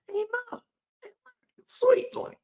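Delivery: high mock valley girl. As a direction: coo it like a teen girl. Continuing the put-on girl voice.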